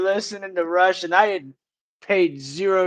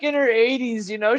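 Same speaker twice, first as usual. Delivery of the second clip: ordinary talk, nothing marked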